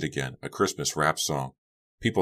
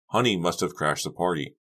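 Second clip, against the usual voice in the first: plain reading voice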